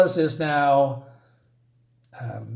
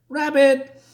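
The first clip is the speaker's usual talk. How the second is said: high call after someone running off; 11 semitones higher, 3 dB louder than usual. Retold or imitated. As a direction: call his name after him, pitched up high. Alice calls after fleeing rabbit.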